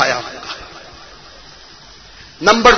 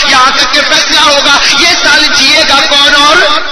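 loud, driving list of questions; full-force preaching; 6 semitones higher, 4 dB louder than usual. Real, not acted. Preacher telling congregation of the night's decrees, forceful preaching. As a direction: hammer out each pair in a steady preaching cadence.

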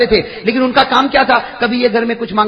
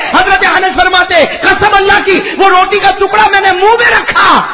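high, loud, slow cry with tears; sobbing sermon shout; tearful preaching; 10 semitones higher, 4 dB louder, slower than usual. Acted. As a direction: weep it out to the crowd. Preacher crying with emotion.

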